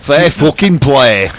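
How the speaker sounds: put-on Yorkshire accent. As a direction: drag it out in broad Yorkshire. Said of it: "You could have said that in a Yorkshire accent".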